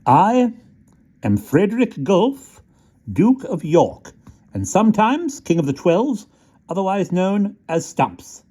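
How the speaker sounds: high, slow, stiffly proud; announcing himself with dignity despite the cold; proud little soldier. A narrator's reading. Small soldier introducing himself to rat, high and slow. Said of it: "controlling the chatter of his teeth".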